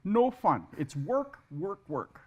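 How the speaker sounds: lightly re-enacted stern announcement to a class; mock yelled orders. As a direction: call out the no-fun rules, half in earnest. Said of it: "the first thing I yell out is".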